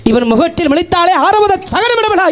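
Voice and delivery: mocking taunt. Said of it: "எப்படி ஏசுவார்கள் நாட்டு மக்கள்?".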